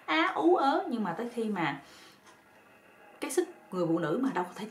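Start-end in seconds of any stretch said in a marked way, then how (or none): 0.1-0.8 high, strained mock cries of alarm; retold cries of protest. Daughter-in-law crying out as father-in-law grabs her, high mock cries. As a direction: squeal it out like a startled woman. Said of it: "cổ còn la á".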